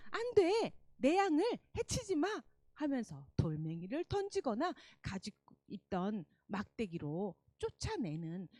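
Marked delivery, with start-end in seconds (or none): 0.1-2.4 high, loud shout to scare off wolves. Acted. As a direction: yell it out bravely. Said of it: "이렇게 외쳤어요".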